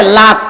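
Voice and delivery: "লাত" with l for r; রায়পুরার টানে "লাত"; Raipura dialect r as l. Shown as a demonstration. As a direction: say "লাত", r turned to l. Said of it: "রাতকে বলে লাত".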